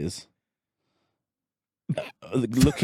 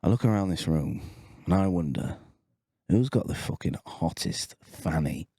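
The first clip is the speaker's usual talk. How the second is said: Johnny Hammers; 4 semitones lower than usual. Retold or imitated. Coworker winding up his desk mate, low and deliberate. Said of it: "He goes".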